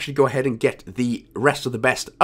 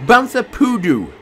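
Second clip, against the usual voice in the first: put-on voice; 8 semitones higher, 4 dB louder than usual. Reads achievement name in voice.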